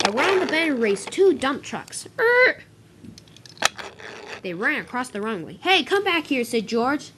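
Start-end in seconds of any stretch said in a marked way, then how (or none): 2.2-2.6 high, loud screeching noise; short, high-pitched vehicle screech. Acted. Tire screech of racing trucks. 5.6-6.4 raised, high-pitched call; urgent shout, moderately loud. Acted. George calls trucks back from runway.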